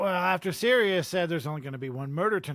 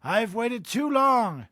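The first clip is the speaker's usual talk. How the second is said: slow, raised, menacing hiss; 5 semitones higher, 3 dB louder, slower than usual. Acted. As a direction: draw it out, higher, as a threat. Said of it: "he hissed".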